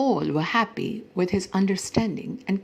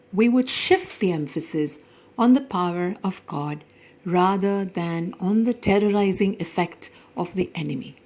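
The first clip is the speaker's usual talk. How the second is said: ordinary delivery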